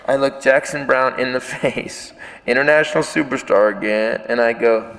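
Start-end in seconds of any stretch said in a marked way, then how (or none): none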